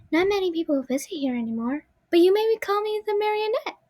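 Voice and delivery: put-on high, light little girl puppet voice; a small girl's voice, lifted high. Puppet greeting a visitor in a little girl voice. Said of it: "It appears to have a little girl's voice".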